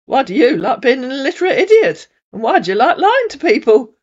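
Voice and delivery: high, loud sobbing mimicry; high tearful mock of his complaint; mocking whine. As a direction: throw his whine back at him. Denise mocks his whine back.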